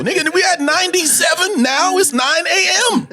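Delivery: high, laughing outraged voice; outraged disbelief. Retold or imitated. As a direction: squeal in disbelief at the heat. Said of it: "I was like".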